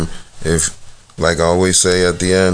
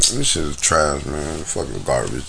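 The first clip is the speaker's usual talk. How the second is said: dismissive; 5 semitones lower, 5 dB quieter than usual. Retold or imitated. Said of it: "just look at them, like".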